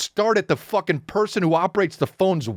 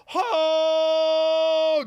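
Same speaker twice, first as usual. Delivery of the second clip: high shouted battle cry; Thundercats battle cry; 8 semitones higher than usual. Retold or imitated. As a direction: roar it like a cartoon hero.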